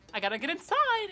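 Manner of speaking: high, loud pleading call; calling through door. Retold or imitated. Teen locked out calling to cousin, loud and insistent. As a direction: holler to be let in.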